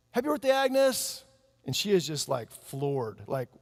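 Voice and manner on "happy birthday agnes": high, louder "Happy birthday, Agnes" called out; surprise party cheer. Retold or imitated. Friends greeting Agnes at her surprise party, cheering. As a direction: shout the greeting as she walks in.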